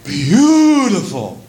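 high gasp, then a drawn-out "beautiful"; acted wide-eyed wonder; awed wife's gasp. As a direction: gasp at a lovely surprise, then coo high and slowly. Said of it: "She goes".

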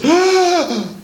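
high, acted gasp of amazement; sudden awestruck intake of breath; awed gasp. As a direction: gasp like a husband seeing his surprise gift. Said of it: "he goes".

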